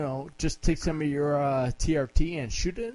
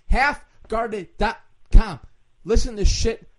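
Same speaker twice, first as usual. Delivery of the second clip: loud announcement; 8 semitones higher, 9 dB louder than usual. Real, not acted. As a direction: call it out like a radio plug. Shouting out website plug.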